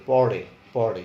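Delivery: পড়ে with a strong curled-tongue flap; retroflex ṛ demo. Shown as a demonstration. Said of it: "মূর্ধা থেকে উচ্চারণ করতে হবে".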